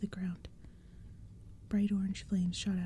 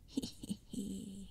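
evil dry cackle; 8 dB quieter, much slower than usual. Acted. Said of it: "an evil, dry sound".

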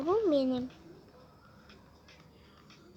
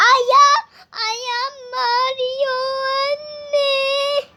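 very slow, drawn-out high wail; crying to mom; 7 semitones higher, 5 dB louder, much slower than usual. Acted. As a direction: stretch it out sobbing for mom. Child cries to mother. (anneye ağlayarak, uzatarak)

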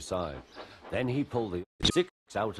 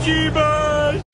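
shouting; 14 dB louder than usual. Acted. Shouted interjection.

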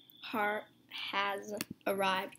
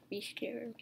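spooky breathy whisper; 9 dB quieter than usual. Shown as a demonstration. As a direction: hush it like a threat. Whispered reread of the message.

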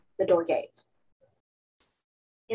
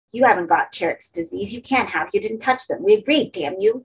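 usual speaking voice

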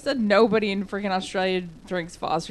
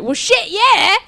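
higher, louder Australian Well, shit, yeah; mock Aussie yell; 11 semitones higher, 4 dB louder, slower than usual. Brent answering the angry neighbor, cheeky Australian yell. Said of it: "Brent was like".

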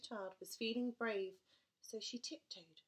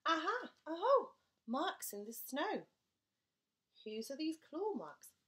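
excited discovery; 7 semitones higher, 8 dB louder than usual. Acted. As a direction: pounce on the find. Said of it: "child discovers marks".

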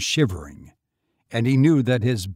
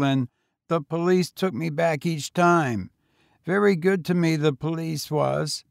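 put-on higher, eager boy voice; young boy; 6 semitones higher, 3 dB louder than usual. A narrator's reading. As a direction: pitch up for a street boy. Boy talks.

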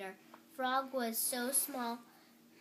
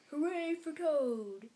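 slow, higher, louder cheer; shouted cheer; 4 semitones higher, 5 dB louder, slower than usual. A narrator's reading. Frog cheering Toad from the audience, drawn-out shout. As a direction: cheer it out, stretching the words. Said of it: "as he shouted".

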